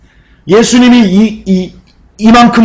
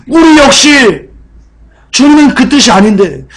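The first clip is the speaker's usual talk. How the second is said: ordinary delivery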